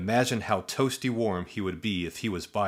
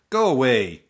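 louder, swatting annoyance; shooing; 4 semitones higher, 8 dB louder than usual. Acted. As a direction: shoo it off loudly. Shooing the buzzing bug.

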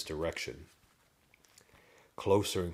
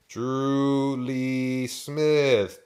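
Drew Lee Smith stretched very slowly, raised and loud; long, loud, slow "Drew Lee Smith"; drawn-out realization; 6 semitones higher, 7 dB louder, much slower than usual. A narrator's reading. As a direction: draw out each word of "Drew Lee Smith" as it dawns on you. Farmer repeats the name, finally understood.

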